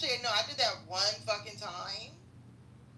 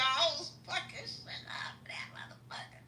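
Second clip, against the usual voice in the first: hushed confession of wrecking things; breathy secret aside; whisper; 7 semitones higher than usual. Real, not acted. Confessing her rampage.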